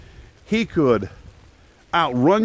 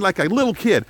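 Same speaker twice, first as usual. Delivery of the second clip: normal talking voice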